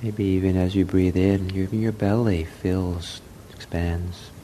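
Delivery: soft, unhurried meditation voice with long pauses; guided meditation. Real, not acted. Leads a breathing meditation.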